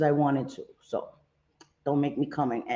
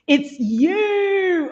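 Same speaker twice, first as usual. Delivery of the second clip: "you" held long and high in mock Anne accent; imitating Anne's accent; 12 semitones higher, 5 dB louder than usual. Host trying to say it like Anne, exaggerated accent imitation. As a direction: stretch "you" super far, pitched way up, like Anne's accent. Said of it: "extends super far".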